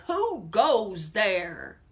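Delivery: loud, booming rhyming villain demand; bully the rabbit for its cake; villain rat crying out. Acted. The highway rat demanding food from the rabbit, booming. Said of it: "who cried".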